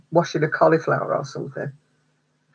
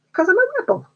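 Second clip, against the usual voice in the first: playful mock rebel; faster than usual. Real, not acted. Playful boast, very high.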